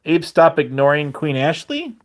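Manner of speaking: ordinary talk, nothing marked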